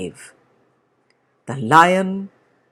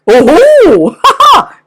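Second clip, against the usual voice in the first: big booming bear laugh, much higher and louder; 13 semitones higher, 13 dB louder than usual. Acted. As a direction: roar with mocking laughter. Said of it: "cried the bear".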